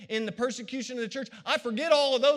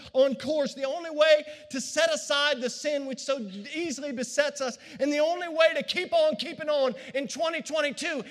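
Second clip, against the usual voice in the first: louder, higher, shouting preacher; full-force preaching; 4 semitones higher, 3 dB louder than usual. Acted. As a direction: drive it home to the congregation.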